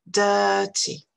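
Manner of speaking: Dirty with a long vowel and no R; British Dirty, stretch the first vowel without any r; R muet britannique. Shown as a demonstration. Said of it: "C'est simplement une voyelle longue". (Dirty à la britannique, voyelle longue sans R)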